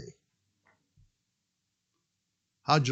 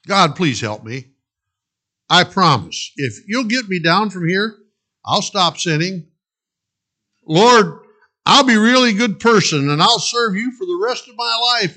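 higher, louder begging; desperate pleading; 7 semitones higher, 7 dB louder than usual. Acted. Jack begging God from the branch, desperate plea. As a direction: beg for rescue, bargaining.

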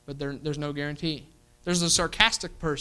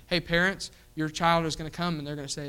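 usual speaking voice

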